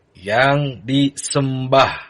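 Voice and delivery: ordinary delivery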